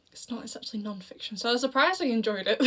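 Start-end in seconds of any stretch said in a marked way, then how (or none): 0.1-1.4 breathy whisper as a quick aside. Real, not acted. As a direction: drop to a secret whisper. Whispered aside about the book.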